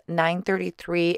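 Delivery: normal talking voice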